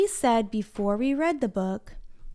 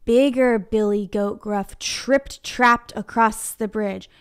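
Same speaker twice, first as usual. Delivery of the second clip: usual speaking voice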